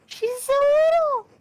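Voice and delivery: high, loud squeal, slowed down; cute squeal. Real, not acted. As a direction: gush over how tiny she is. Squealing over tiny character.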